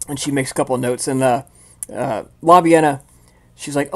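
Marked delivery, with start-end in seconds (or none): none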